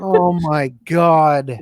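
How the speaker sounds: slow, loud, drawn-out groan of disbelief; exasperated oh my god. Real, not acted. Co-host reacting to the article, drawn-out loud exasperation. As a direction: stretch it out in pained disbelief.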